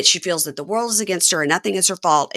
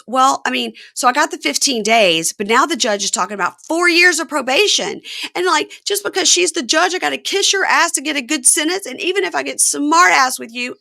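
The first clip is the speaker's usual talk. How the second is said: imitating her complaint; 9 semitones higher, 4 dB louder than usual. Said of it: "And she goes".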